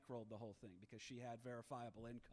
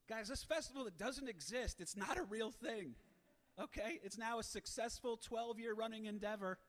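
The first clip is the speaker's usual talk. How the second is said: higher and louder, riled up; worked-up rant; 7 semitones higher, 5 dB louder than usual. Real, not acted. Comic ranting to the audience, higher and louder. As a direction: whip the crowd up with mock outrage.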